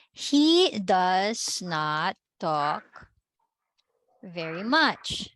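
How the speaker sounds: very slow, word by word; slow dictation. Shown as a demonstration. Teacher giving the class the English answer, slow dictation. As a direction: stretch each word out, pausing midway.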